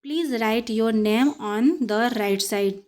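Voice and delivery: every word drawn out; slow sentence reading. Shown as a demonstration. Teacher reading the write and right sentence, very slow. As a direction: dictate it at copying speed. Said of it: "चलिए sentence देख लेते हैं".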